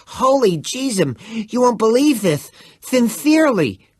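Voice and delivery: put-on high, loud excited boy. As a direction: bubble over with news. Excited boys talking, voiced high.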